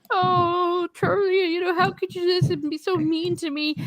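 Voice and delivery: high-pitched, hammed-up tearful girlfriend; mock crying. Girlfriend pleading with Charlie in a retold scene, mock crying. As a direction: imitate a girlfriend pleading through fake tears. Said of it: "she starts to cry".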